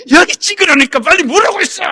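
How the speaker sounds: high, loud furious yelling; angry yell. Retold or imitated. Husband yells at his wife in the polling booth. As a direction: bark the order at his wife. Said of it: "소리를 막 지르고 야단난 거예요".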